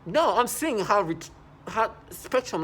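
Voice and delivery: ordinary talk, nothing marked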